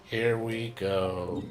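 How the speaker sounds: drained, droning mock pop idol, slowing down; depressed Barbara. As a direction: sink into a joyless, dragging fake cheer. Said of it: "they made her just super depressed".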